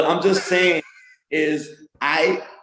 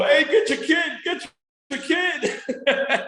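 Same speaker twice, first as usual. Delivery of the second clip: dad's voice; 13 semitones higher, slower than usual. Retold or imitated. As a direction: shout it high like grandpa wants help. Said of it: "and then he'll go".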